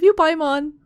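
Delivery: a loud happy cry to a favourite character; excited gush. Real, not acted. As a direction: blurt it out with delight. Gushing at the game character.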